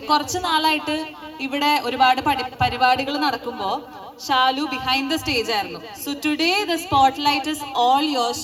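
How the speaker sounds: slow, drawn-out MC welcome; stage announcer. Acted. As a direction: announce her onto the stage.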